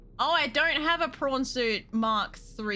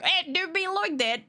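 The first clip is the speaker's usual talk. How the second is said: mock emote voice; 8 semitones higher than usual. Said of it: "I really dislike".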